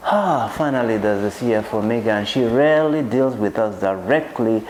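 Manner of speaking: normal talking voice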